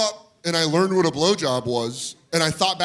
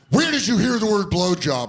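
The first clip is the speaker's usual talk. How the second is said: demanding dad; 6 semitones higher, 3 dB louder than usual. Retold or imitated. Retold dad demanding an answer.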